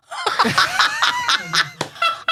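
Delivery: a loud, high burst of laughter. Real, not acted. Laughing out loud to her co-hosts about the stewardess. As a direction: crack up at the absurd memory.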